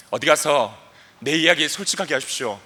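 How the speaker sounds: normal talking voice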